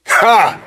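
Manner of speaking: single high-pitched "ha"; one sharp burst of amusement; mocking laugh. Laughing at the prophet, a mocking laugh. Laughs at his own mockery.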